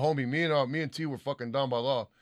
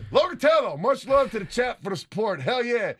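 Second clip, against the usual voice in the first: shout-out read; 8 semitones higher, 5 dB louder than usual. Acted. Reads out a supporter's message.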